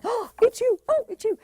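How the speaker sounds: thrilled oh it's you; delighted. Retold or imitated. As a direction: beam as you say it. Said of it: "your sparkly bits are going".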